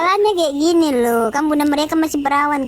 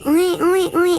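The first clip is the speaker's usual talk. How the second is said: put-on baby crying; 4 semitones higher than usual.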